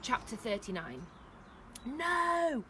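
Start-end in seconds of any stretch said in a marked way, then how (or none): 1.8-2.6 loud, high cry of protest; screamed. A narrator's reading. Chaya trying to get to the door, screamed. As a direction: scream a long NO. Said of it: "Chaya screamed".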